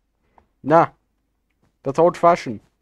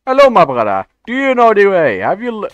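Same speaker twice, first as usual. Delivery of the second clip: louder and higher meme voice; loud Knuckles greeting; 6 semitones higher, 7 dB louder than usual. Acted. Gamer loudly greeting a teammate in the meme voice, zealous. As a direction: hail him like a zealous preacher.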